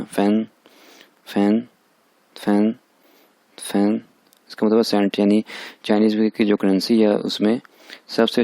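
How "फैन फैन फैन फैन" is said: फैन level, not drawn out; short first tone. Shown as a demonstration. Teacher warning against lengthening फैन, short level tone. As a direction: keep फैन brief and even. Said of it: "इसको ज़्यादा लंबा नहीं करना".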